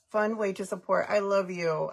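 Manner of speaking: ordinary delivery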